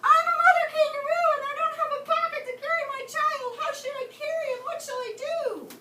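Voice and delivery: high, loud desperate scream. Acted. Katie to the deaf owl, high loud scream. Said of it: "she screamed".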